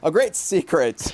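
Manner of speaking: dramatic lore reading. Acted. Player rereading the misread lore line, dramatic. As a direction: put weight on the secret.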